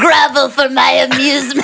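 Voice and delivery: Decepticon voice. Acted. [name] showing off her Decepticon voice to David, mock menace. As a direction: lord it over them like a cartoon robot villain. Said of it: "that was your Decepticon voice?".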